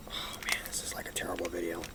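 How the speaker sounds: whispered despairing aside. Real, not acted. Frustrated tutor mutters to himself, whispered. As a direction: breathe it out under your breath.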